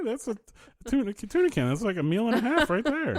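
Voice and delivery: high mock thrifty voice. Mock thrifty housewife bit.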